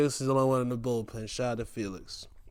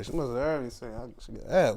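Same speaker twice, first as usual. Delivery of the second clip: ordinary delivery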